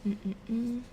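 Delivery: normal talking voice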